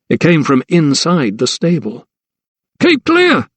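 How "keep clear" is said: high, urgent yell to stand back; warning shout. A narrator's reading. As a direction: bark out a warning over the noise. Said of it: "shouted a hostler nearby".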